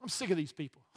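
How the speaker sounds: mock fed-up Jesus. A preacher imagining Jesus giving up on people, mock weary. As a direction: grumble it like you've had enough. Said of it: "This would have been my version".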